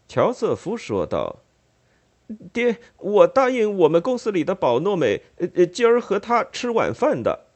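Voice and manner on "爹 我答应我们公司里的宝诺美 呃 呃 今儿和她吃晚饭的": much higher, hesitant stammering excuse; a flustered son fishing for an excuse; flustered brother. Acted. Joseph makes excuse to father.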